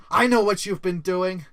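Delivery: rising from firm to a full shout; accusing shout. Acted. Employee confronting his corrupt boss, accusing shout.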